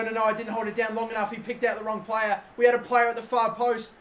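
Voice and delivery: usual speaking voice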